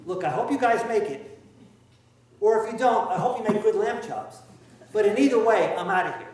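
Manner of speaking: flippant and loud, laughing through it; cheeky, careless brush-off with a laugh; snarky. Acted. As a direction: shrug them off like a hired hand walking away. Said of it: "in a kind of a more snarky way".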